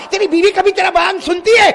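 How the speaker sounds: loud high mocking jab; shouted taunt about his wife. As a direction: heckle the preacher. Someone taunts the preacher.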